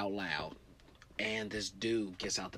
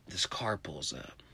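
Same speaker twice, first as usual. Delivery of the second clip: whispered, slow ominous setup; hushed suspense; 5 semitones lower, 5 dB quieter, slower than usual. A narrator's reading. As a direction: draw them in with a whisper. Suspense in story.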